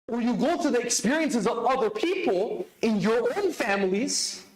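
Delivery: normal talking voice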